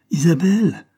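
loud, high anguished call; anguished cry. A narrator's reading. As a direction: cry out the name in alarm. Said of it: "cria-t-il d'une voix pleine d'angoisse".

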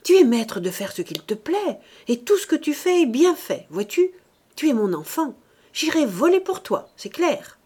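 assurance insouciante, voix forte; insouciance sublime. A narrator's reading. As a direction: tout approuver d'un ton haut et fort. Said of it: "avec une insouciance sublime". (ton d'une insouciance sublime)

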